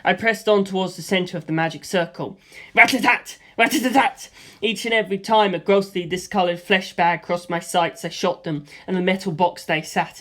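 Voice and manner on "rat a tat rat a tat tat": quick rat-a-tat bursts; mouthed machine gun; gunfire noises. A narrator's reading. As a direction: tap out the shots.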